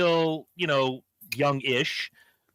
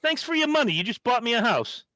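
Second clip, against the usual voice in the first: much higher, smug thanks; mock greedy Gene; 8 semitones higher than usual. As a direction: gloat over the fan's money. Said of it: "I got shit to do".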